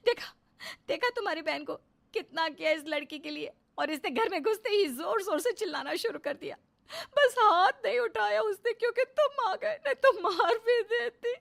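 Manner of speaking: wife fake-crying to her husband about his sister, high and loud; fake crying complaint. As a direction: pile on the drama with crocodile tears. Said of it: "बीवी के झूठे आंसू".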